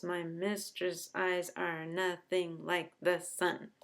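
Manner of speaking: slow, evenly spaced "My mistress' eyes are nothing like the sun"; syllable by syllable. Shown as a demonstration. Teacher showing the line has ten syllables, syllable by syllable. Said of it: "we've got ten syllables".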